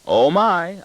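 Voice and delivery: high, loud burst of mock shock; big startled exclamation; exclaiming. A narrator's reading. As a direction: exclaim with the voice leaping up. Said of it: "I exclaim".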